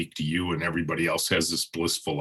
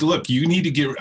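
higher, louder imitation of wife cutting in; impatient wife talking over her husband; mock bossy interruption; 10 semitones higher, 8 dB louder than usual. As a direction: snap it out over him.

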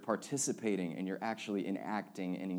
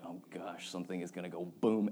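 nervous hush; 5 semitones lower, 5 dB quieter than usual. Retold or imitated. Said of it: "you're like".

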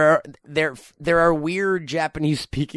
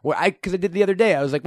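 normal talking voice

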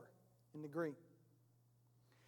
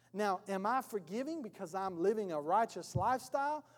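normal talking voice

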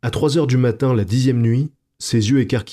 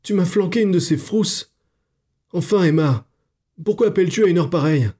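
high, rattled scolding; frightened reproach; shaken; 9 semitones higher than usual. A narrator's reading. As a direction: still jumpy from the scare, fast at the end. Frightened Clara scolds her friend.

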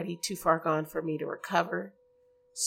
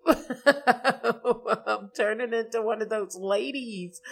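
slow, laughing self-mockery; 4 semitones higher, 4 dB louder, slower than usual. Real, not acted. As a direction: laugh at yourself. Laughing at herself.

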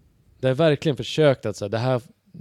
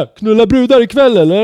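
much higher, loud boorish shout; rowdy party lad; 13 semitones higher, 15 dB louder than usual. Retold or imitated. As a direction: bellow it like a drunk lad on holiday. Lad shouts crude invite from balcony.

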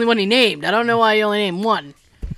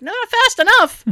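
high-pitched mock panic; acted-out urgent impatience; comic alarm, pitch shooting up; panicky retort; 11 semitones higher than usual. Acted anxious retort about snake.

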